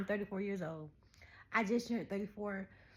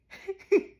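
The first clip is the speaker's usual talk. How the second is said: hard laugh; 11 semitones higher, 12 dB louder than usual. Real, not acted. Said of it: "I'm laughing so hard".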